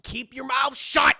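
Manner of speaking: slow, tense, emphatic mock threat; acted exasperated order, ending loud; urgent warning. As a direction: warn a friend through gritted teeth. Chip warns Gadget urgently.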